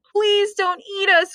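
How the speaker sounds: scared dogs begging; pleading cry. Acted. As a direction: beg the monster to spare you. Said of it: "cried the dogs".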